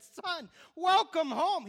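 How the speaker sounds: father crying out his welcome to his returning son, slow and loud; joyful father. Acted. Father welcomes lost son home.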